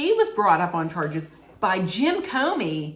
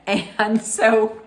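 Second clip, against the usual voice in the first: ordinary talk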